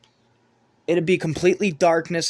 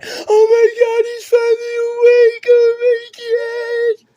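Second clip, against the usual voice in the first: shrill, much higher, much louder wailing; hammed-up sobbing shout of relief; screaming crying woman; 8 dB louder, slower than usual. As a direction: shriek it like a hysterical relative crying. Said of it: "some fat woman screaming and crying".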